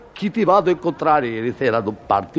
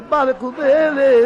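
high, slow, dramatic lament; 11 semitones higher, slower than usual. Acted. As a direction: cry it out like grand opera. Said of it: "al mare".

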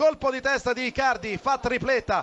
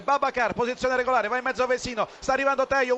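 ordinary talk

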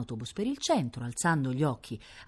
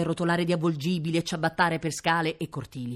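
plain reading voice

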